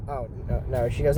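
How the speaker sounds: normal talking voice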